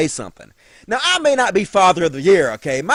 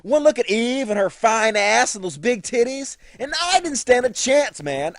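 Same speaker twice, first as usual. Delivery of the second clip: normal talking voice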